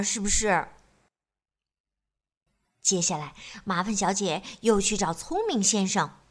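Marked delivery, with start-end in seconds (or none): none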